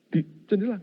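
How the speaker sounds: normal talking voice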